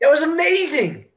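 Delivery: very high, loud squeal of joy; elated shout. Acted. Musician to bandmate after the gig, elated. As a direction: cry out, thrilled.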